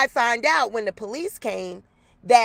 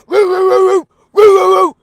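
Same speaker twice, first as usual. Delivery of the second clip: high, loud woofing pushed from the chest; imitating the dog's chest bark; chesty dog woof imitation; 9 semitones higher, 13 dB louder than usual. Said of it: "he do it with his chest".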